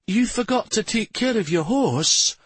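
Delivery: put-on high-pitched boyish disbelief; stunned. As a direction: ask in stunned amazement, voice way up. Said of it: "in a stunned tone".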